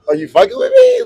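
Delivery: high-pitched mock disbelief; incredulous reaction. Tourist doubts the stranger's claim, incredulous reaction. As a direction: squeak out the retold disbelief.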